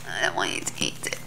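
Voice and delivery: hushed unvoiced warning; whisper. Real, not acted. Mum whispering a warning to her baby. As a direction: whisper it so she won't wake fully. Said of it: "whispered warning to baby".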